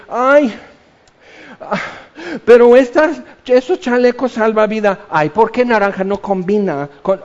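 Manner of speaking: high, fussy voice picking at lifejacket colours; whiny mock complaint. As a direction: play a petty complainer sinking on the Titanic. Titanic victims fussing over lifejackets. (queja melindrosa y burlona)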